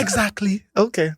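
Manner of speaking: "Exactly" with an H put on the front, pitched high; mimicked phone voice. Son mimics his Jamaican mom's phone voice, H added to "Exactly". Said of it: "add an H to everything".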